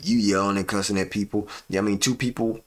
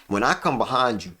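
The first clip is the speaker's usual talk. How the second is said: ordinary talk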